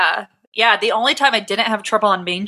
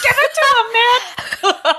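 panicky plea; 12 semitones higher, slower than usual. Retold or imitated. Urging friend during French fry robbery.

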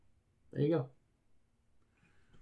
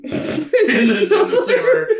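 laughing through it; 13 semitones higher, 4 dB louder than usual. Real, not acted. Laughing while telling the story.

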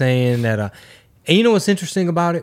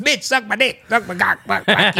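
put-on high-pitched kid voice reciting swears; mock boy impression, pitched up; excited son; 7 semitones higher than usual. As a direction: imitate a child parroting dirty words. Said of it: "he's excited".

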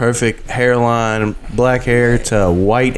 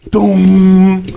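high, louder imitated bang of a foot on steel; imitated thud; 8 semitones higher, 6 dB louder than usual. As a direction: make the sound of the impact. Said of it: "all you hear is".

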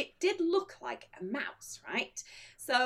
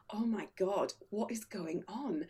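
low, soft inner voice; worried undertone; 5 semitones lower, 8 dB quieter than usual. Retold or imitated. Diner watching an annoyed man, worried undertone. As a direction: think it aloud, hushed.

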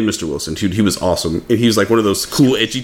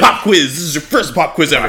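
mock teacher; 7 semitones higher than usual. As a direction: bark out a pop quiz like a smug teacher. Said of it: "They're like".

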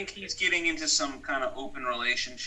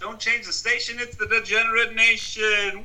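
announcer voice; 8 semitones higher, 8 dB louder than usual. Acted. Podcast intro announcer call.

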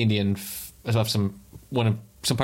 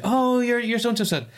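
put-on high, bright old lady lighting up with joy; delighted old lady; 13 semitones higher than usual. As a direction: let her voice jump up in happy recognition. Old lady recognizes friend.

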